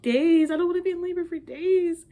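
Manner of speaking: high, loud shocked cry of disbelief. Retold or imitated. New mother told labor could take days, shocked high outcry. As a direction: recoil at the news, voice jumping up high and loud. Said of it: "I'm like".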